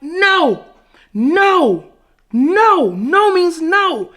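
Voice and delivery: high, loud, drawn-out protests; emphatic acted refusal.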